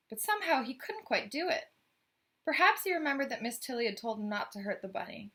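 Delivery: ordinary delivery, nothing marked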